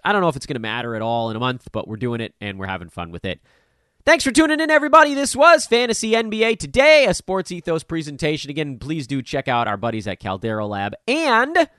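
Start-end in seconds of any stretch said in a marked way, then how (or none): 4.0-8.5 upbeat sign-off. Acted. Show sign-off announcement.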